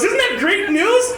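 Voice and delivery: high, slow, delighted mom voice; mom's excited news. Retold or imitated. Mom announcing the honeymoon news, high and slow. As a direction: gush it like a thrilled mother. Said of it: "And my mom goes".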